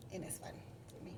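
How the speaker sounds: hushed, quick, almost voiceless giggle; giggling aside. Real, not acted. Teasing her husband to viewers, a hushed giggle. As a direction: let it slip out through a laugh.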